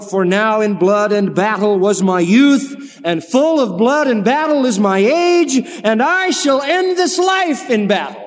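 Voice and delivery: slow, lifted, solemn recital; poem recitation. Acted. Preacher reciting Arnold's lines to the congregation, slow solemn recital. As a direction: declaim each line slowly like a battle poem.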